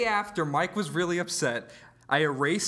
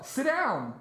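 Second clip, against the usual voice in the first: mock yell; 9 semitones higher than usual. Yelling at friend mid-podcast.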